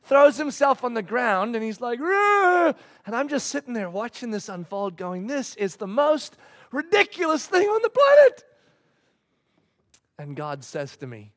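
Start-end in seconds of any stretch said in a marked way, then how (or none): 2.0-2.7 high, loud mock tantrum growl; mad toddler's roar. Son throws himself on the ground, mock angry roar. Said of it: "he's still mad". 5.3-8.4 high-pitched mock exasperation, building; exasperated disbelief. Father watching his son's tantrum unfold, mounting exasperation.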